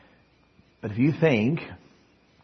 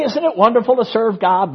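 high, loud gushing church talk; mock pious enthusiasm; 8 semitones higher, 4 dB louder than usual. Joyless churchgoer putting on a show of praise, mock enthusiasm. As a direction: gush it out as hollow praise. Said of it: "But what they really believe is".

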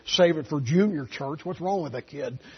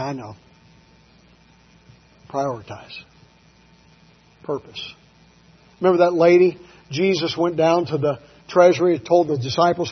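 ordinary delivery

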